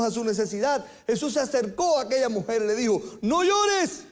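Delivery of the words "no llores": high, louder cry of no llores; urgent plea. Acted. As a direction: call out no llores high and strong. Jesus comforts a weeping woman.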